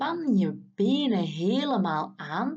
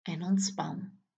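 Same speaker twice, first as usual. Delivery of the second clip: whispered release. Acted. Release cue after tensing.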